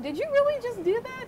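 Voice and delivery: high, loud incredulous question; can't believe what you just heard; coworker's shocked high question; stunned disbelief. Retold or imitated. Coworker stunned by her reply.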